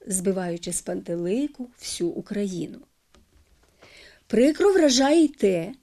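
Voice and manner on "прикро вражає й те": normal talking voice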